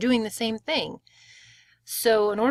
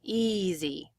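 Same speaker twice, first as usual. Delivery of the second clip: "Easy" with a long first syllable, softly falling off; stress on first syllable; 5 dB quieter than usual. Shown as a demonstration. Teacher echoing the model, "Easy" with first-syllable stress. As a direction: draw out the start of "Easy", let the end fade. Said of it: "it kind of falls off there at the end".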